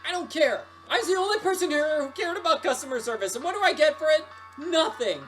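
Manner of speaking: high, heated venting; worked-up, bitter complaint; angry rant. Acted. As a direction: let the resentment pour out. Fired worker rants at customers.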